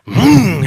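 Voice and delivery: loud vocal car-engine vroom; engine revving noise. Retold or imitated. Preacher mimicking the car as it drives off, engine vroom. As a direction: make a revving engine sound.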